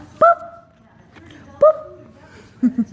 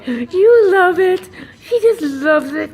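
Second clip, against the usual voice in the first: gushing coo; 6 semitones higher, 5 dB louder than usual. Real, not acted. Owner gushing to her bird, high teary coo. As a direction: gush over her like she's precious.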